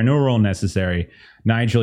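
normal talking voice

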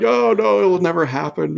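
high, loud scoffing denial. Retold or imitated. Friends dismissing his warning, high scoffing voice. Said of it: "They're like".

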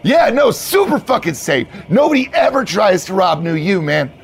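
high, emphatic bro insistence; hyped-up bro assurance. Acted. Manager assures new hire it's safe, hyped-up bro. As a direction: oversell the safety.

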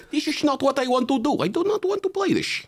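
very high-pitched, put-on foreign voice; squeaky caricature of a stubborn Dutchman; mock Dutch athlete. Comic voicing tall Netherlander refusing basketball, high mock-Dutch voice.

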